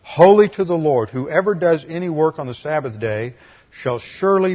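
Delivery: loud, proclaiming Bible reading; Scripture reading. Acted. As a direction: declaim the verse to the congregation. Preacher reads Scripture aloud.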